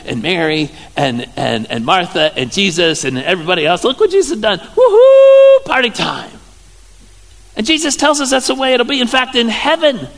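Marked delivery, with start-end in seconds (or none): 3.8-6.3 high, whooping mock celebration; celebrating partygoer. Party guest cheering what Jesus has done, celebrating. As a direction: whoop it up, then drop to normal.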